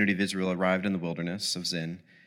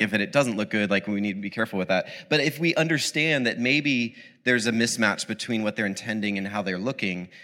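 normal talking voice

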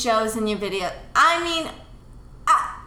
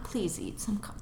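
whispered plea; 11 dB quieter than usual. Real, not acted. Mom pleads about carbs.

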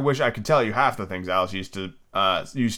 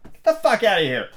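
raised shocked shout at an intruder; startled angry yell; 9 semitones higher than usual. Pretend. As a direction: yell at Tom to get out, pitched up. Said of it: "You look out the window and be like".

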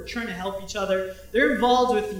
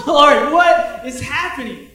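exasperated prayer; 5 semitones higher, 10 dB louder, slower than usual. Retold or imitated.

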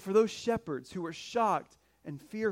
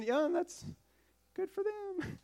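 sarcastic shrug; 10 semitones higher, 6 dB quieter than usual. Retold or imitated. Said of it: "the shepherds could have been".

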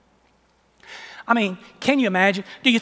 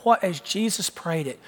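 usual speaking voice

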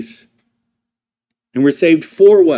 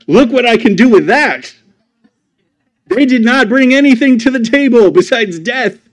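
voicing God; 7 semitones higher, 5 dB louder than usual. Preacher voicing God's view.

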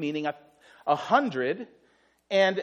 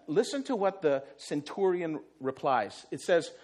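usual speaking voice

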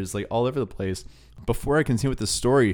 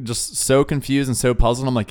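ordinary talk, nothing marked

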